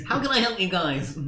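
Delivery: high, nasal-free nerdy shopkeeper. Acted. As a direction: play an eager nerd greeting customers. GM voices nerdy NPC again.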